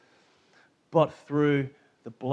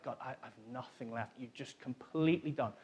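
weary prayer; 3 semitones lower, 11 dB quieter than usual. Retold or imitated. Exhausted believer praying, voiced quietly.

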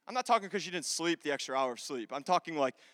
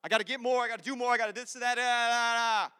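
run-on rising-pressure list, raised pitch; swirling thoughts; 3 semitones higher than usual. Acted. Pastor acting out thoughts that swirl through his head, run-on. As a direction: let it tumble into a droning da-da-da. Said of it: "these thoughts swirl through my head".

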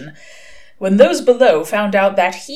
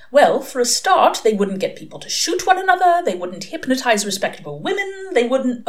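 higher-pitched, indignant angel; mildly scandalised angel in a raised voice; Crowley's angel foil, Aziraphale; 7 semitones higher than usual. A narrator's reading. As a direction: angel listing objections, voice climbing.

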